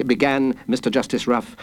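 ordinary delivery

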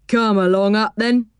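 lightly voiced friendly driver; a hint of a kindly old engine driver; train driver. A narrator's reading. Driver inviting Joe into the cab, lightly voiced.

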